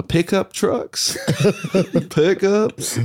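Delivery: slipping into a Canadian accent. Real, not acted. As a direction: say it the Canadian way, high and drawn out. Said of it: "You just slipped into your Canadian accent".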